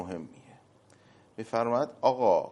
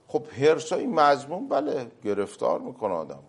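ordinary delivery

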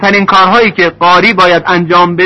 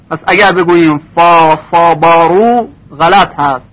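ordinary delivery

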